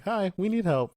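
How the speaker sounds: high, loud imitated greeting; tension-breaking call. Jubilee pops in to break the tension, high mock call. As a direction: pop in with a high mock hail. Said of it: "breaks the tension in a cool way".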